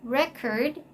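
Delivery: "Record" stressed on the first syllable; "Record" said REK-erd; noun stress. Shown as a demonstration. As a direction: lean on REC in "Record". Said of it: "is actually a noun".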